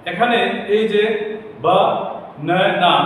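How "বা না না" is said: বা, then না না, set apart; syllable by syllable. Shown as a demonstration. Teacher pointing out three syllables, বা না না broken up. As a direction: pause after বা to show the syllables. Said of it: "এইখানে তিনটি syllable রয়েছে".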